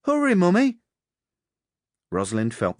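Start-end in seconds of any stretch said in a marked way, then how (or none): 0.0-0.8 put-on high, loud child urging his mother on; urgent little boy's holler; eight-year-old boy yelling. A narrator's reading. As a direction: shout impatiently for your mother. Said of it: "He was only eight".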